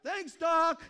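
high, loud laughing thanks; a gleeful, laughing thanks; giddy thanks. Retold or imitated. Patient thanking doctor for morphine, laughing. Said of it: "it's like".

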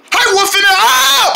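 high startled yelp; startled scream. Acted. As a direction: jump at the scare. Startled by jump scare.

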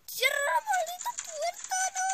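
high panicked shout at the screen; panicked yell. Real, not acted. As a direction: shriek it in panic. Panicking at the game door. (grito agudo de pánico)

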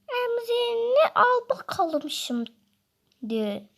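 high, slow teasing voice; taunting character voice. Acted. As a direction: drag it out slowly, high and mocking.

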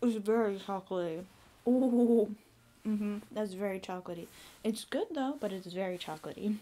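talking while chewing food; mouth full, chewing. Real, not acted. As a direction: hand over full mouth. Seen 4: Bites mochi at -1; hand covers chewing mouth while speaking.

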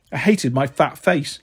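usual speaking voice

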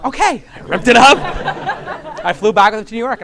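cracking up at the memory; laughing. Real, not acted. Recounting ripping up the ticket to his friend, laughing loud.

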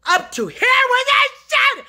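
high, furious yelled outburst; real furious shout. Real, not acted. Fed up with the voices, furious shout. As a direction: yell it, at the end of your rope. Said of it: "This yelling and screaming".

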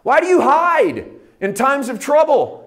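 high, loud desperate question; pleading cry. Acted. Psalmist crying to a distant God. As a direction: cry out why God hides. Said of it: "Psalm ten one".

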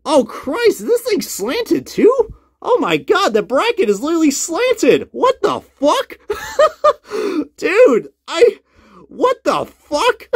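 high-pitched shocked outburst; shocked disbelief. Real, not acted. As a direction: react in disbelief at the damage. Shocked at broken toy truck.

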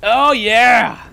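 loud high whoop; excited cheer. Real, not acted. Gamer cheering at a win, loud and high. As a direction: celebrate out loud.

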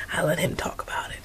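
soft unvoiced whisper, as if sharing a secret; whispered aside. Real, not acted. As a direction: drop to a hushed whisper. Confessing aside to viewers.

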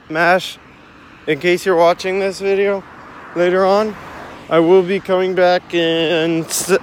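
loud message to a viewer; calling out. Real, not acted. Vlogger to Mash in case they watch later, calling out loudly.

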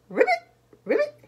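high, quick ribbits; very high-pitched frog call. Acted. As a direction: chirp it fast like a frog. Bullfrog character croaks.

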